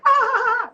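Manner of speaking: high, loud burst of fake hysterical laugh; mock hysterical laughter. Shown as a demonstration. Guest showing the host what hysterical laughter sounds like, mock cackle. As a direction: squeal out a crazed laugh. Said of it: "like, hysterical laughter".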